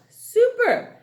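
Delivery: high, loud "Super"; excited praise. Real, not acted. Teacher praising kids, high and loud. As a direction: cheer them on. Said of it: "teacher praising class".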